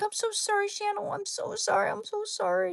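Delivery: put-on very high, pleading baby voice; high-pitched sorry to the dog; high apologetic squeak. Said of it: "I'm like".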